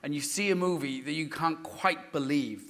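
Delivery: ordinary talk, nothing marked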